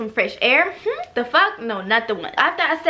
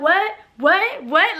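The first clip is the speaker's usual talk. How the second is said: angry what; 6 semitones higher, much slower than usual. Retold or imitated. Angry confrontation retold.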